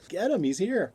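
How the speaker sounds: high, loud mock alarm; retold urgent shout. Man tells the cop about the intruder, mock shouting. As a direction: call out to the cop in a retold scene. Acts out Thompson alerting the cop.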